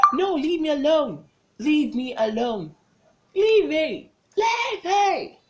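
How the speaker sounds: high, loud cries to be left alone, pushing him off; desperate screaming. Retold or imitated. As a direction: scream it like you're fighting for your life. Retold struggle against attacker.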